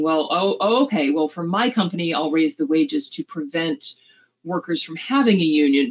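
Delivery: usual speaking voice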